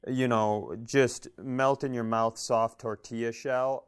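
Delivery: ordinary talk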